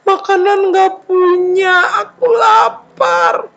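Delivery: high, drawn-out mock crying; sob-wail in hammed-up misery; sad wailing. Jobless son with nothing to eat, wailing tearfully. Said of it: "Dia sedih".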